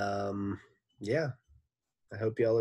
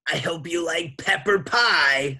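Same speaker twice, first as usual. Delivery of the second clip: Miss Mush voice; 9 semitones higher, 10 dB louder than usual. A narrator's reading.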